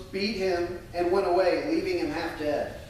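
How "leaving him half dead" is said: ordinary talk, nothing marked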